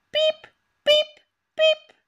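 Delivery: high squeaking rodent; rat squeak. Retold or imitated. Imitating a rat.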